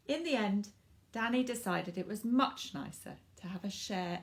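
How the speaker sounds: lively storybook narration for little ones; reading to children. A narrator's reading. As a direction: read it like bedtime story time. Reads picture book to children.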